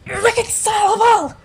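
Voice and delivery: loud, high strained outburst; anguished yell. Acted. Newly fused creature appalled at itself, yelling. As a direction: yell it at the top of the voice.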